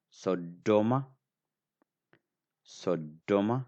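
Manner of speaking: Sodoma with a doubled d, held before release; strong sound. Shown as a demonstration. Teacher quizzing learners on the strong sound, doubled d. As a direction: pause into the d of Sodoma, then push it out. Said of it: "I say it deeper".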